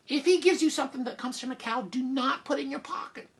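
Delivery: high-pitched mom, reining herself in; exasperated mother, instructing. Acted. Mother telling Jack about the cow, exasperated. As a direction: lay down the rule in a high voice.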